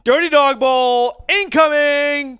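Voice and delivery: loud, slow, drawn-out announcer call; hype shout. Real, not acted. Hyping a guest chugging beer.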